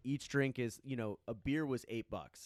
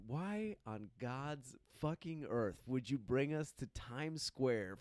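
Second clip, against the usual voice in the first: slow, drawn-out mock outrage; exasperated; slower than usual. Retelling a complaint to the friend about Times Square, exasperated. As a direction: stretch every word in disbelief.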